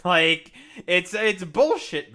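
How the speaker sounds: slow, loud, indignant stress; exasperated outburst. Real, not acted. Streamer fed up with a politician's claim, exasperated.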